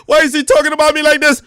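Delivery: high, loud whiny mock of a woman complaining; shrill mock complaint; mocking her voice. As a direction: squeal it back at her like a hurt victim.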